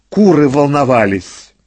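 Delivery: plain reading voice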